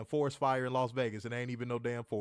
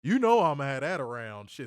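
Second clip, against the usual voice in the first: normal talking voice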